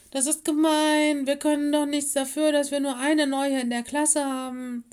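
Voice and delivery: high, loud pupil's complaint; indignant protest. A narrator's reading. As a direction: protest loudly against the unfairness. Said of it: "begannen leise zu protestieren".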